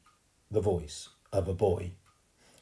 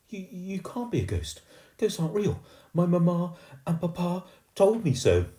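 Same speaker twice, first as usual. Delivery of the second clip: put-on high, stammering posh girl; well-bred girl denying ghosts; posh girl insisting; 11 semitones higher, 6 dB louder than usual. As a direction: insist primly.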